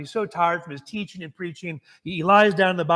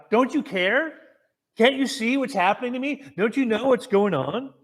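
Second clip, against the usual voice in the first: crying out to God; 6 semitones higher than usual. Retold or imitated. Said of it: "crying out to God".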